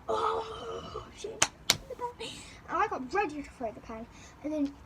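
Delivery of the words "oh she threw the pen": high gasping tease; mock gasp. Classmates reacting to the thrown pen, high mock gasp. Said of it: "people were like".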